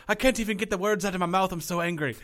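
hammed-up angry rant in a bad accent; Sheamus, terrible accent. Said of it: "that was a terrible accent".

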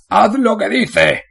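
high, slow, strident plea; shrill. Acted. Warden with a gun to his head pleading shrilly. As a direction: screech the order up at the wall. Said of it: "La voz del alcaide era estridente".

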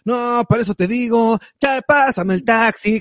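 high, loud hammed-up old-movie Mexican; mock golden-age cinema chilango; old Mexican film voice. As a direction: ham it up like a classic Mexican film star. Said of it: "así como hablaba [name] y Don Ramón".

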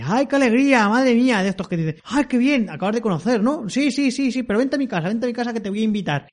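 high, gushing voice of a clingy stranger; overeager pushy host. Retold or imitated. The tiresome man greets the hobbits in a high gushing voice. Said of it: "un señor cansino".

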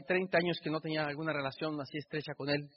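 ordinary talk

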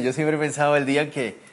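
usual speaking voice

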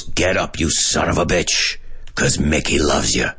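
throaty, guttural trainer voice; Mickey from Rocky. Retold or imitated. Teacher imitating Rocky's trainer as example, throaty. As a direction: growl it low from the throat. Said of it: "That's a throaty voice".

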